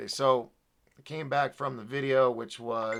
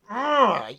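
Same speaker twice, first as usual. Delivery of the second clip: higher-pitched frustrated "ugh"; exasperated groan; 5 semitones higher, 4 dB louder than usual. Real, not acted. Exasperated mid-rant.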